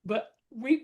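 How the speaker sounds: ordinary talk, nothing marked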